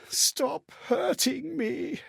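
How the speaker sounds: put-on slow, whispered child's plea; a terrified child begging under his breath; frightened child whisper. Beaten child whispering to the one hurting him, frightened and slow. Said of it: "far too young for such cruelty".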